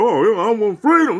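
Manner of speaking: mocking quote. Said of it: "People are like".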